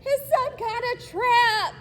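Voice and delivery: high, loud, alarmed; frightened. Acted. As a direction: call out scared. Boy trapped in net.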